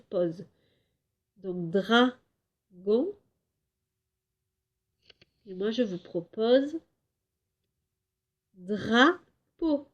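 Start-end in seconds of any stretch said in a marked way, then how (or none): 1.7-3.2 dragon drawn out as dra... gon; slow dragon with a long break after dra; syllable by syllable. Shown as a demonstration. Teacher giving pupils time to think, dragon split in two. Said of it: "c'est dra". 8.5-9.9 drapeau split as dra... peau; syllable by syllable. Shown as a demonstration. Teacher proposing a word to pupils, drapeau broken in two. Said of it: "la syllabe dra".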